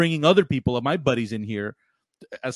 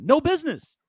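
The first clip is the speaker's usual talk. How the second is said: exasperated; 12 semitones higher than usual. Real, not acted. Repeating his rant point.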